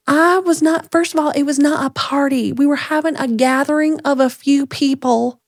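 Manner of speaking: high-pitched imitation of a Southern woman; defensive small-town Southern voice, mocking; mock Southern accent. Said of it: "it's this little southern town".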